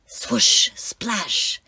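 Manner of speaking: breathy whispered swoosh and splash; whispered sound effects. A narrator's reading. Narrator whispering the sound of the waves.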